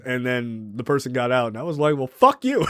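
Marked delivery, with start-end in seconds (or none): 2.0-2.7 raised-pitch replay of an argument's parting shot; re-enacted angry send-off; retold yelling. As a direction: throw it out high, as a mild mock yell. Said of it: "yelling back and forth".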